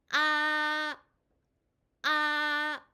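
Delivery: 아 held long, high and level, loud; first tone. Shown as a demonstration. Teacher drilling learners in first tone, high level 아. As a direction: sustain 아 on one high, unwavering note. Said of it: "일성 연습합니다".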